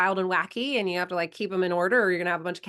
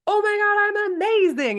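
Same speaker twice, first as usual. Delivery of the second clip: put-on high, loud, over-the-moon child; proud kid; 14 semitones higher, 7 dB louder than usual. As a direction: squeal it like a kid amazed at herself. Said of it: "she's like".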